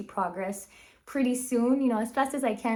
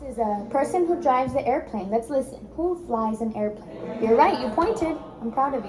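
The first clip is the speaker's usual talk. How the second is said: put-on bright, upbeat coaching of little kids; teacher voice to kids; 2 semitones higher, 4 dB louder than usual. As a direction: cheer them on like a speech therapist. Therapist coaching young kids.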